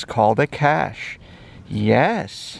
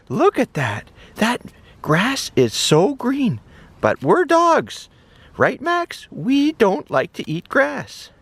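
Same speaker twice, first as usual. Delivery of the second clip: high, bright puppy voice, eager and friendly; high-voiced cheerful puppy; little dog voice; 9 semitones higher than usual. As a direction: play the small excited dog, pitched well up. Toy dog Max voiced in play.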